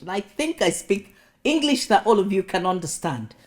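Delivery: ordinary talk, nothing marked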